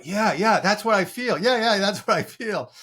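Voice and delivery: a stunned man finally feeling understood; amazed agreement. Retold or imitated. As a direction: blurt it out in amazement, half laughing. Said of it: "his jaw's dropping because it's like".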